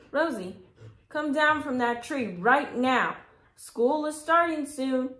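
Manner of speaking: loud, high call up into a tree; father calling his daughter down from the tree, loud. A narrator's reading. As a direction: call her down from the branches. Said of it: "called the man from down below".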